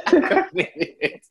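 slow, laughing repeat. Real, not acted. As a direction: let the laugh carry the words.

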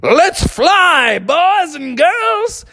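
high, drawn-out rallying cry; Brother Bear. Acted. Bear to the sleigh team at take-off, high drawn-out cry. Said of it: "And Brother Bear said".

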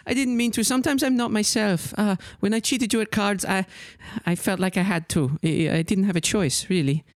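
put-on high, sorry young woman voice; tiefling apologizing for cheating at cards; apologetic tiefling.

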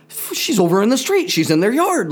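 high-pitched mock-anxious protest; fretting parent. Dad to Carol about wandering daughter, high worried protest.

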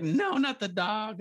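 mock dismay. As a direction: beg the movie to spare the dog. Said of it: "I was like".

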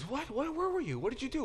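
high, startled questions; astonished Naomi. Retold or imitated. Naomi startled as Ruth comes home, high astonished questions. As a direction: gasp it out in disbelief. Naomi shocked at Ruth's grain.

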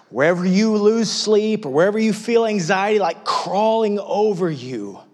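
impassioned sermon climax; preaching at full force. Real, not acted. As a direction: lift it to a cry. Peak of preaching.

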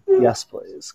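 breathless whispered plea; breathy moan. A narrator's reading. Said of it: "Camille moaned".

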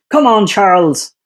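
self-berating Leclerc. Retold or imitated. Panellist imitating the driver every time he crashes, self-berating. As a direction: mimic a driver scolding himself after a crash. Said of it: "every time he crashes, he goes".

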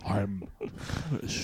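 breathy near-whisper of I'm finished. Retold or imitated. Friend echoes the film's final line in a hush. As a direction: let it die away, spent. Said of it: "Daniel Plainview goes".

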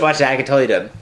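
normal talking voice

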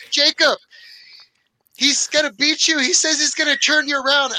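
high, loud call across the track; retold yelling. Kid's friend warning Jacob across the karts, loud high yell. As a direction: call out to a buddy over the noise. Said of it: "his friend was yelling back to him".

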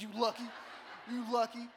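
hammed-up kid's warning, punched out; mock angry threat. Eight-year-old held back by grandpa, mock furious.

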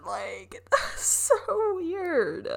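high, drawn-out words through a laugh; laughing disbelief. Real, not acted. Laughs at her viral moment.

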